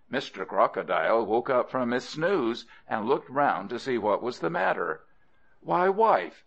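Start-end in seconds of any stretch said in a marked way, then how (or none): 5.6-6.4 high-pitched, startled husband; surprised concern, pitched well up; puzzled surprise. Acted. Crocodile husband to his crying wife, puzzled surprise.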